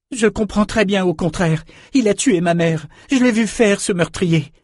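put-on high, outraged young girl; furious accusation. A narrator's reading. Girl insists she saw him kill her mother, high outrage. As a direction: argue back in a high, furious voice.

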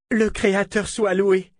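high-pitched, elated outburst of relief; joyful exclamation. A narrator's reading. Scholar praising the Creator at good news, exclaiming joyfully. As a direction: exclaim it like sudden thanks to heaven. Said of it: "s'exclama Warren".

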